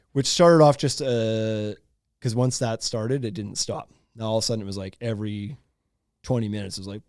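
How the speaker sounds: normal talking voice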